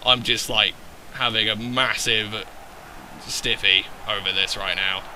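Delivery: low, soft murmur of admiration; hushed awe. Real, not acted. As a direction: marvel quietly, almost to himself. Awed aside over his team.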